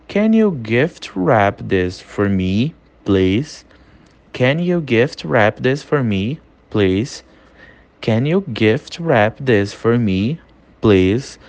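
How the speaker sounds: slow, clearly spaced teacher model; slow classroom drill read; slow repeat-after-me. Shown as a demonstration. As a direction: say it slowly so students can copy. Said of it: "Tudo que eu falar em inglês, você vai ler e você vai repetir".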